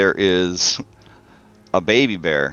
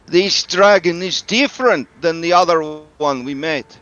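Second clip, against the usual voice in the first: high, louder foreign-sounding character voice; woman crying out; 9 semitones higher, 4 dB louder than usual. Acted. Game master's Kavani woman cries out about the dragon, crying out. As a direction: cry it out high and deliberate. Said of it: "The woman cries out".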